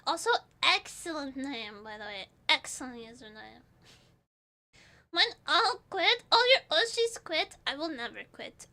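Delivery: ordinary talk, nothing marked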